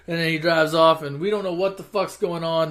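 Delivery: ordinary delivery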